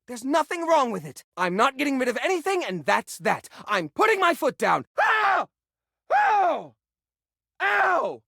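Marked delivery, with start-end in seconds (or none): 5.0-8.2 sharp high yelps of pain, three times. Acted. Hoarder stepping on a thumbtack, yelping. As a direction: yelp as the tack bites.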